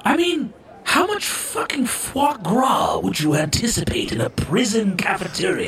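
incredulous outburst. Acted. Outraged about the foie gras in prison.

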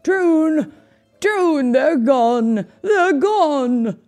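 mournful shouts. A narrator's reading. King discovering his stilts are gone, anguished shouting. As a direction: wail out the loss. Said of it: "the king's mournful shouts".

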